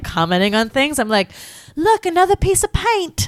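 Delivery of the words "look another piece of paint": higher, hammed-up British accent. Kid commenting on presents in a put-on British accent. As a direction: chirp it in a prim British accent. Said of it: "a British accent".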